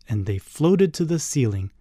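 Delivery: ordinary delivery, nothing marked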